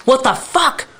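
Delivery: high-pitched, incredulous cursing; retold outrage. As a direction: replay being dumbfounded and annoyed. Retold outrage as dryer starts.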